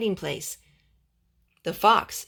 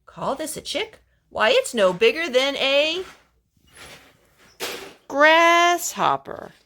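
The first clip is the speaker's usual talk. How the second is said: mother hen; 5 semitones higher, 6 dB louder, slower than usual. A narrator's reading. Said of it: "The mother hen ... and said".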